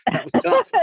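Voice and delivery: high laughing through the words. Real, not acted. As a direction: say it while laughing. Laughing off swallowing a bug.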